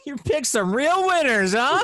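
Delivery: slow, high, sneering "You picked some real winners, huh?"; mocking condescension. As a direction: imitate her as smug and patronizing. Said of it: "That sounded so condescending".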